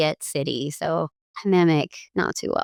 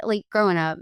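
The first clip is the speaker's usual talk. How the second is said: normal talking voice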